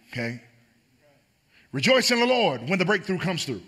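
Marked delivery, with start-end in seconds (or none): 1.7-3.6 higher and louder, building refrain; preaching. Acted. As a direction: preach each rejoice harder. Preaching at full force.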